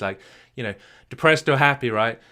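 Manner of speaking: ordinary delivery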